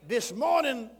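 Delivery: high, loud climactic shouting blessing; sermon climax, shouting; preaching at full force. Real, not acted. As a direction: shout the blessing over them.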